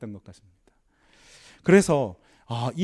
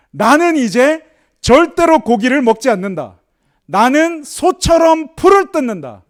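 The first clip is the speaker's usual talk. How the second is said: slow, much higher and louder declaration; solemn proclamation; 7 semitones higher, 7 dB louder, slower than usual. Acted. Preacher voicing the lion's vow, a slow loud proclamation.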